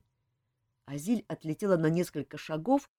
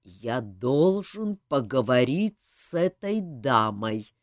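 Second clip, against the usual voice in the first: low, slow threatening words; slow and menacing; 6 semitones lower, slower than usual. A narrator's reading. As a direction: menace each word slowly. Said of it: "медленно и угрожающе произнёс он".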